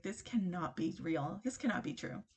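soft, incredulous aside to oneself; quietly voiced inner disbelief; hushed disbelief. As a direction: retell a stunned thought under your voice. Retold stunned inner reaction on the date.

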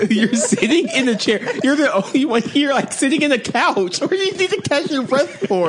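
high laughing teasing; laughing tease. Real, not acted. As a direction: tease him through laughter.

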